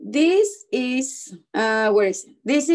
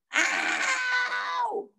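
very high, drawn-out "ow"; mock pained yell; 13 semitones higher, 4 dB quieter than usual. A narrator's reading. Boy bites apple with loose tooth, mock pained yell. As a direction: wail it high in pretend pain. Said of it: "and yelled".

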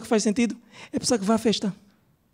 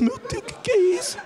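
shocked exclamation; 9 semitones higher than usual. Retold or imitated. Shocked at sixteen men.

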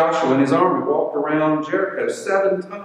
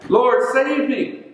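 high, drawn-out cry for help; mock yell, pitched well up and stretched out; retold yell; 9 semitones higher, slower than usual. Sinking Peter calls to Jesus for rescue, retold high yell. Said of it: "He yells out".

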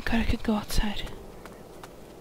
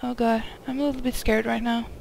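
usual speaking voice

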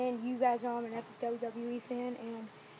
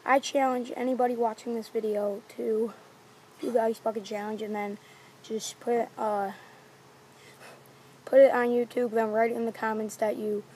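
in ice cold water; 8 dB louder than usual. Real, not acted. Soaked and freezing, issuing challenge.

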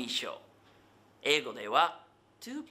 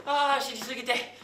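high, loud exasperated groaning delivery; 大げさな嘆き; 5 semitones higher, 4 dB louder than usual. Acted. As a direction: wail that you're worn out, voice pitched up. 方言例文で疲れを嘆く小芝居. (うんざりした嘆き声)

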